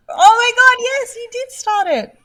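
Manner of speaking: high, loud exasperated protest; indignant outburst. Real, not acted. Co-host insists the other started it, indignant outburst. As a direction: burst out high, then drag out the accusation.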